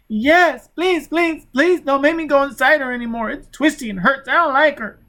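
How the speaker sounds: put-on high, loud, desperate pleading child; high terrified child begging. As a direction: beg not to be sent in.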